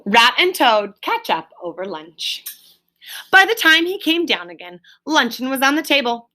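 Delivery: ordinary delivery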